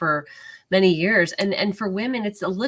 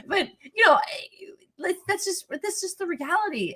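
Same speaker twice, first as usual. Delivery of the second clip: breathy fading laugh; 10 semitones higher than usual. Real, not acted. Recovering from laughter, emotional.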